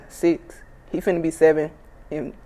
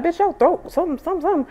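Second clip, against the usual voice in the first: imitating mom; 11 semitones higher, 4 dB quieter, faster than usual. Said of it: "She like".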